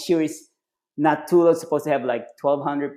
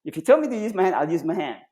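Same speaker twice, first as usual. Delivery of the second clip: indignant recruit; 7 semitones higher, faster than usual. Retold or imitated. Recruit arguing back.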